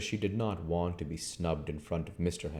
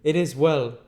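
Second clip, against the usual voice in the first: higher, curt stranger; stranger's voice; 7 semitones higher, 4 dB louder than usual. Acted. Stranger answering Henfrey, higher curt voice. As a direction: play the aloof stranger, pitched up.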